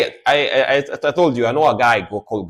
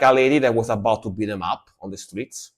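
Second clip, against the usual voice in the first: normal talking voice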